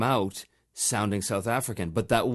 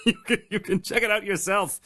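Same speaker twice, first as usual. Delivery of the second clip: laughing; 9 semitones higher than usual. Real, not acted. Laughing during his self-plug.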